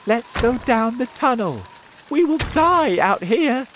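high, loud, desperate call; rally everyone in acted panic; urgent shout. A narrator's reading. James urges friends into the tunnel, shouting. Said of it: "shouted James".

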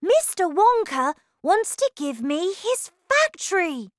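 put-on high, loud, urgent child; eager boy calling to mother; excited cry. A narrator's reading. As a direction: insist on being heard with big news. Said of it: "cried Charlie".

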